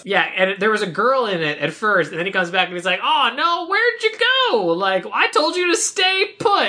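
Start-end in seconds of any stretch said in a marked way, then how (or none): 3.0-6.6 high, hammed-up farmer's lament; mock distressed scolding. Farmer finds the girl gone from his truck, mock distressed scolding. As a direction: wail it in a put-on high voice, drawing out the end.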